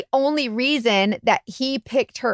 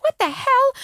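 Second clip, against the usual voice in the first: retold angry scream. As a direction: imitate the wife shrieking back at him. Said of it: "she starts screaming back at him".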